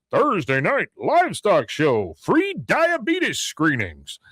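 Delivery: slow, drawn-out announcer imitation; booming-announcer parody, rising high; Big Tex. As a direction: announce it like a fairground giant. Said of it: "you hear from Big Tex".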